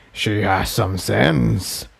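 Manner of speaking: low, very slow and soft; ancient being. Acted. Tantalus remarks on Incendia fleeing, low and slow. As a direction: purr it with cold approval. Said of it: "Tantalus said".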